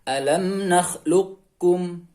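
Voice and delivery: "nakhluqkum" chanted slowly, heavy q running into k. Shown as a demonstration. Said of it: "tapi tanpa ada qalqalah".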